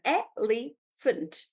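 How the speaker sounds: elephant said slowly, syllable by syllable; slow syllable demo. Shown as a demonstration. Teacher showing another way to split elephant, slowly. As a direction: draw out elephant in three parts. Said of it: "I can also do it like".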